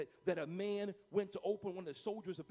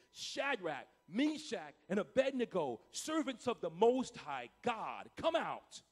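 loud, declaiming call, names set apart; shouted summons; 5 dB louder, slower than usual. Acted. King calling into the blazing furnace, shouting to the three men. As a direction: call them out with royal force. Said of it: "approached the opening of the blazing furnace and shouted".

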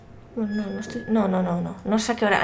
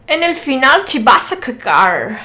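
louder Spanish with English vowels and r; imitated English accent; 2 semitones higher, 6 dB louder than usual. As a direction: mimic an English speaker reading Spanish. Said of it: "que habla con acento inglés".

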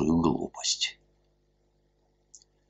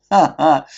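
high loud bark of a laugh; 11 semitones higher, 8 dB louder than usual. A narrator's reading. Masked man laughing at the officials, loud. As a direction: laugh in their faces.

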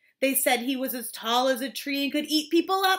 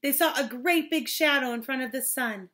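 usual speaking voice

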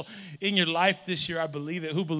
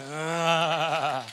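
usual speaking voice

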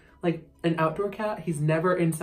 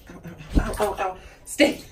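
ordinary delivery

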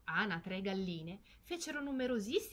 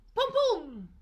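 gunshot imitation; 11 semitones higher, 11 dB louder than usual. A narrator's reading. Gunshots in story.

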